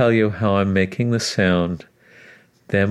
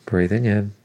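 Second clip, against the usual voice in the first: guided breathing cues; 3 dB quieter than usual. Acted. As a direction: count the breaths gently, unhurried.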